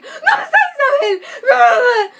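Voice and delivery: loud, slow, high mock mom call through laughter. Teen imitating mom calling outside the room, loud and laughing.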